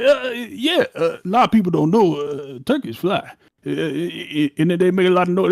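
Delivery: loud, high grandpa impression, halting with uhs; storytelling papa. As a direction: imitate Papa explaining turkeys. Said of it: "My papa was telling me a story".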